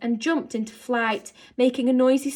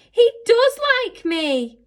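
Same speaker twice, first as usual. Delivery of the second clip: high, slow, delighted laughing line; happy laugh; 13 semitones higher, 8 dB louder than usual. A narrator's reading. Girl holding the beetle, laughing happily. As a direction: giggle with joy. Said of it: "laughed Novak happily".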